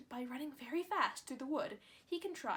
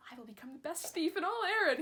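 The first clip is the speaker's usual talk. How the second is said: loud, higher, eager boast; joyful cry; 5 semitones higher, 8 dB louder than usual. A narrator's reading. Boy jumping for joy to his mother, loud and eager. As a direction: crow it out with glee. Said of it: "he cried".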